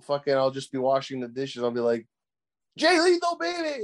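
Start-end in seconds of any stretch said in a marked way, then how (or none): 2.8-3.8 high, loud hype shout; Jay Lethal baby, shouted high and giddy; excited yell. Retold or imitated. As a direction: yell it like you're pumped at the sink. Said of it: "they just rambling 'cause I'm excited".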